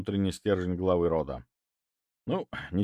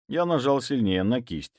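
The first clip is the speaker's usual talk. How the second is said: ordinary delivery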